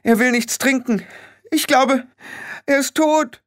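very high panicked wife voice; frantic falsetto cry; alarmed. A narrator's reading. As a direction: report it in a high-pitched panic. Alarmed wife calling for help.

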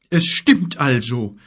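slow, shaken giant's voice; shocked giant. Acted. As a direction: let the shock slow him. Gork, shocked.

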